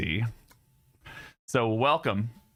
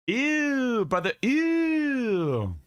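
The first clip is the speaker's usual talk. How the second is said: high squeaky ew; 10 semitones higher, much slower than usual. Pretend. Shouting a meme catchphrase.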